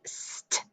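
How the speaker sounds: "st" as unvoiced consonants alone; hissed s closed by a t in "st"; isolated initial blend. Shown as a demonstration. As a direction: sound out "st" softly with no vowel. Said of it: "It's the initial blend".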